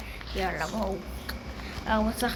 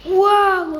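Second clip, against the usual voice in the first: loud, higher startled wow; 7 semitones higher, 9 dB louder, much slower than usual. Real, not acted. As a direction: blurt it out startled. Startled by scare. (וואו מבוהל ורם)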